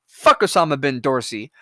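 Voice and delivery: ordinary talk, nothing marked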